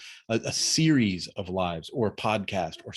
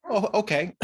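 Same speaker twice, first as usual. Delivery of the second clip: caught off guard; 9 semitones higher than usual. Retold or imitated. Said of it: "I go".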